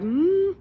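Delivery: a high doubtful hum; mock suspicious hmm; skeptical hmm. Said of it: "and I'm like".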